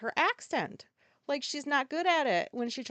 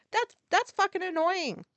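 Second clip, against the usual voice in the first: louder, higher annoyed outburst; exasperated; 9 semitones higher, 5 dB louder than usual. Real, not acted. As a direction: let the irritation boil over. Ranting about actors' accents.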